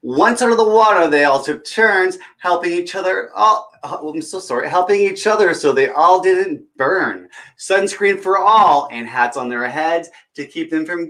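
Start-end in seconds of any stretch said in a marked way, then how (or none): none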